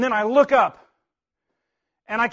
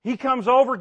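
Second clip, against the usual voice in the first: normal talking voice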